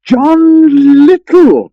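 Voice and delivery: long, high, loud hail; a stretched-out shout of greeting; calling out. Acted. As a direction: call his name across the river, drawn out. Friar calls out to Little John.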